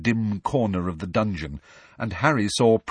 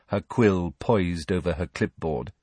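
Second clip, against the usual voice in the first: plain reading voice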